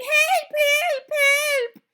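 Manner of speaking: high mock cries for help; panicked teachers yelling. A narrator's reading. Witch mimicking teachers on their desks, high mock yells. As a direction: squeal like a frightened teacher.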